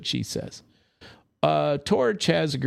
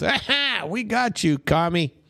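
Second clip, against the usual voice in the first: mock gloating interrogator; 7 semitones higher than usual. Mock accuser gloating at catch.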